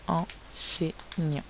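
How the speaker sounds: "enseignant" split as en, sei, gnant; measured teacher's dictation of "enseignant"; syllable by syllable. Shown as a demonstration. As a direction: say "enseignant" slowly with a gap between syllables. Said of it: "sur les syllabes".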